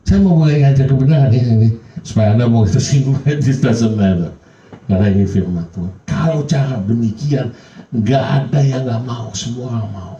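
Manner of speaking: high, loud, pressing sermon voice; full-force pulpit preaching; fervent preaching. Acted. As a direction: preach it up to the congregation with fire.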